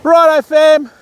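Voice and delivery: high, loud, drawn-out call; excited announcement. Real, not acted. Angler telling viewers of first cast, high excited call. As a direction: announce it big, stretching the words out.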